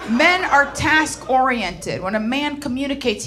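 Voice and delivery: ordinary talk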